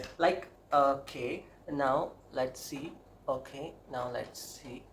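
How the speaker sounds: slow drawn-out English; very slow drawl. Real, not acted. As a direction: stretch every word out very slowly.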